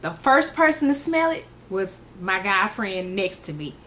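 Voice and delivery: usual speaking voice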